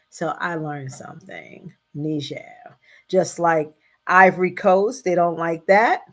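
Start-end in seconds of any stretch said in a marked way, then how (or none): 1.9-2.5 Niger said the French way, nee-ZHAIR; Niger with soft zh, stress at end; Niger as nee-ZHEH, French; French pronunciation. Shown as a demonstration. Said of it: "The proper pronunciation is Niger".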